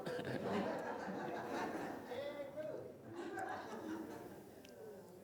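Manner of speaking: long laugh after a quip; laughter. Real, not acted. Attendees react to the outside voice joke, laughing. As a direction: keep the laughter rolling.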